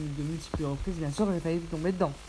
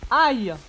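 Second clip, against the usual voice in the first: ouch cry; 8 semitones higher, 10 dB louder than usual. Real, not acted. Gets hurt in game.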